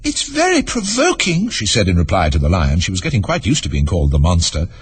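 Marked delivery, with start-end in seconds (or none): 0.0-1.5 put-on very high, slow girl's voice; high-pitched storybook Alice; vexed girl. A narrator's reading. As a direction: complain as a small, exasperated girl.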